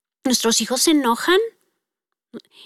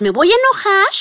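louder and higher, a mock defiant kid; a child testing his strength, half-mock angry; 8 semitones higher, 5 dB louder than usual. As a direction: play the child daring the parent.

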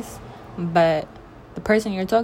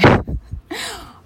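burst of high laughter; 8 dB louder than usual. Real, not acted. Laughs at her own line.

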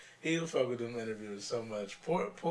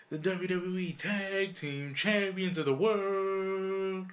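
booming ring announcer, higher pitch; ring intro; 4 semitones higher than usual. Retold or imitated. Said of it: "We use it as an intro".

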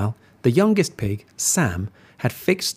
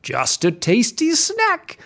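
smiling wolf; 12 semitones higher, slower than usual. Acted.

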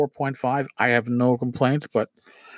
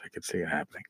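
softer and lower, half to himself; muttered repeat; 10 semitones lower, 13 dB quieter, much faster than usual. Real, not acted. Host muses about brawls at the table, softer and lower. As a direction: let it fade out as an afterthought.

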